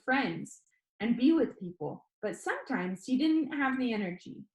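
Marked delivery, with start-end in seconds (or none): none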